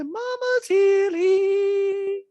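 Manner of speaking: very high, loud, long-drawn call; Diana impression. Reality star announces herself to daughter, high drawn-out mock call. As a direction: stretch it out in a squealing caricature. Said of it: "Diana Natagnia goes".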